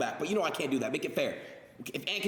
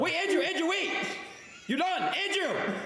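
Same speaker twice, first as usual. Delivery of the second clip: calling out; 14 semitones higher than usual. Real, not acted. Calling out that he's finished.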